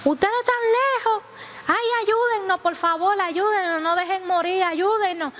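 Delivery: high, urgent begging for rescue; acted desperate plea. Stranded passengers begging the fishermen for help, desperate plea. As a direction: plead for help across the water.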